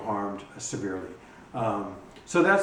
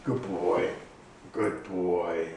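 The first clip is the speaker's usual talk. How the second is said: praising the dog; 5 semitones lower, much slower than usual. Real, not acted. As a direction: gently tell him he did well.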